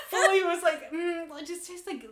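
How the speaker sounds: high, laughing retelling; barely holding it together, laughing. Retold or imitated. Wife retelling husband tasting milk, laughing.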